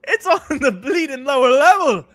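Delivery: high, loud mock astonishment; over-the-top amazed outburst; put-on exclaiming voice. Put-on British-slang bit, high and loud.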